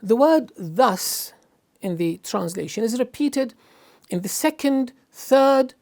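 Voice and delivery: ordinary talk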